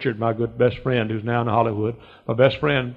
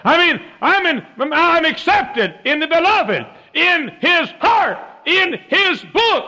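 very high, slow shouted proclamation; full-force preaching; 6 dB louder, slower than usual. Real, not acted. Preacher declares he is accepted, full-force preaching. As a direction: hammer out each phrase slowly at a shout.

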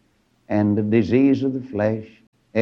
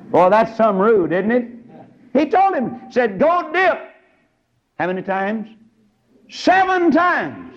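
high, ringing pulpit voice; preaching at full force; 12 semitones higher, 4 dB louder than usual. Acted.

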